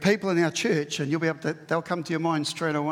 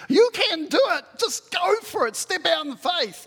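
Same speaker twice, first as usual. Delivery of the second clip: high, eager cheering-on; excited encourager; 11 semitones higher, 4 dB louder than usual. Acted. Preacher voicing an encourager cheering someone on, high and eager. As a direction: pump them up like a cheerleader.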